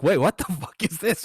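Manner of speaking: high-pitched baffled reaction with a laugh; incredulous double take; mock disbelief. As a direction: act out being thrown, laughing through it. Acting out own baffled reaction.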